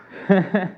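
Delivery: high, loud nervous giggle; embarrassed little laugh. Real, not acted. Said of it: "estoy nervioso".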